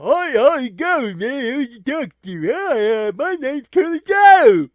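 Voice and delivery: high, loud goofy sidekick voice; Curly Joe voice. Acted. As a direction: play a dopey, eager buddy. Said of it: "you know, it's like".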